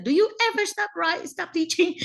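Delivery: husband's high, incredulous question, imitated; mock exasperated husband. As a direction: tease with a high-pitched spousal protest.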